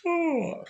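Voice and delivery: high, put-on yawn sound; fake yawn. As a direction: stretch out a hammed-up yawn. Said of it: "fake yawn".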